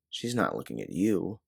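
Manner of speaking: ordinary delivery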